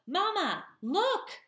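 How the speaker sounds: very high, loud squeak; squeaky gosling. A narrator's reading. Gosling calls to his mother as he lifts off, high squeak. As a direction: squeal it out like an excited little gosling. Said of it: "he squeaked at his feet".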